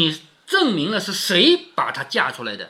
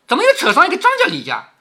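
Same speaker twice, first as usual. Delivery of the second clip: fast, high, loud incredulous question; baffled challenge; 10 semitones higher, 5 dB louder, faster than usual. Acted. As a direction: interrupt with impatient disbelief. 凤姐不解地打断追问. (又急又高地质问)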